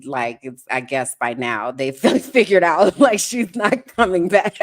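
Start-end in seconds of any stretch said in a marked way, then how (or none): 1.9-4.6 high, loud laughing delivery; amused at people finally realising she left, laughing high and loud. Real, not acted. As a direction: laugh through the punchline.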